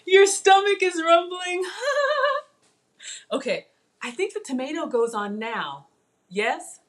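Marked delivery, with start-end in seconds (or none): 0.1-1.6 high, loud, laughing delivery. Real, not acted. Cook amused by viewer's hungry comment, laughing. 1.7-2.4 high-pitched giggle; high laugh. Real, not acted. Cook amused by viewer's comment, giggling high.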